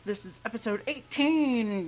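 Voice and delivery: quirky host intonation; weird inflection. Real, not acted. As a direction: put a strange lilt on the announcement. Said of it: "I just did the weirdest voice inflection ever".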